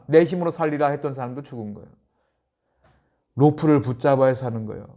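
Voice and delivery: ordinary delivery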